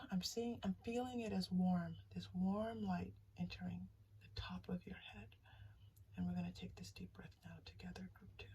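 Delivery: hushed whisper leading the breath; whispered guided breathing. Acted.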